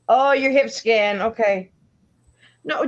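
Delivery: ordinary delivery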